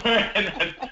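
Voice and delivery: a real, high burst of giggling; helpless laugh. Real, not acted. Host laughs at Heather, then apologises. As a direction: break into a laugh you can't stop. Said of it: "I shouldn't be laughing".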